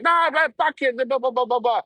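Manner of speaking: high dismissive put-on voice; the local waving off the apology; mock yokel voice. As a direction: brush it off in a high silly voice. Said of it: "this and that".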